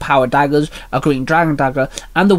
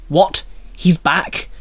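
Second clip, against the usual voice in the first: mildly startled double question; startled blurt; 3 semitones higher, much slower than usual. A narrator's reading. Minnie learns the murderer has returned, lightly blurted surprise. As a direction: blurt it with a touch of shock. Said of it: "Minnie blurted".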